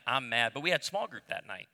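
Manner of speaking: ordinary delivery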